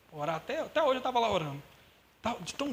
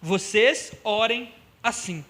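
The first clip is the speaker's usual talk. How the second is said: slow, louder verse reading; slow emphatic quote; 6 dB louder, much slower than usual. Acted. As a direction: declaim the verse slowly. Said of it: "Vamos lá no versículo nove". (versículo lido devagar e com força)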